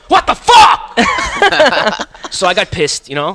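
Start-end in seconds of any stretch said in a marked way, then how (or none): 0.1-1.0 loud, high shout of disbelief; outraged shout. Real, not acted. Venting about being kicked out. 1.0-2.3 high cackling laugh; loud laughing burst. Real, not acted. After his outburst.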